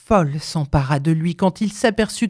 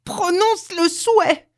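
very high, drawn-out command; insistent repeated cry; 14 semitones higher, slower than usual. A narrator's reading. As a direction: repeat the order slowly and shrilly high. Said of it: "répéta la". (ordre crié, insistant)

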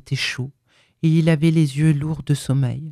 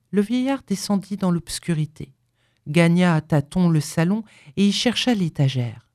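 ordinary delivery

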